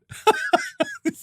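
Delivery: short breathy scoff, high; a quick derisive burst of breath; scoffing laugh. Real, not acted. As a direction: laugh it off in disbelief. Scoffs at quoted guest.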